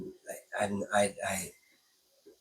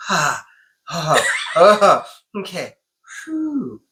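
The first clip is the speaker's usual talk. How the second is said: startled reaction; 7 semitones higher, 8 dB louder, much slower than usual. Retold or imitated. Acts out split-second gut reaction.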